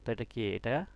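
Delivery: ordinary delivery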